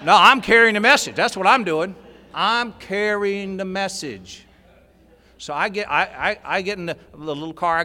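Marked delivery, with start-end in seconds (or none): 0.0-1.9 loud, proud proclamation of duty; proud declaration. Retold or imitated. To himself, swelling with pride over his task: a loud declaration. 2.3-4.5 drawn out slowly, each word savoured; slow, savoured repeat. Retold or imitated. Repeating his duty to himself, slowly and smugly.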